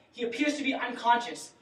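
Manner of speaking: normal talking voice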